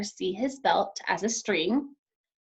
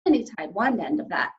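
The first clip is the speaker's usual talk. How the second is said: ordinary delivery, nothing marked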